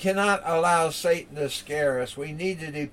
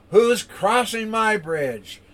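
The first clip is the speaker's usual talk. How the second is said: higher, louder, slow troll roar; 7 semitones higher, 6 dB louder, slower than usual. Acted. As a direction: roar the challenge slowly from under the bridge. Troll challenges the middle goat.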